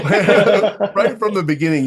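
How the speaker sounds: slow, high, laughing through the words. Real, not acted. Laughing after joking about kissing up to his co-host. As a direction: giggle it out after the joke.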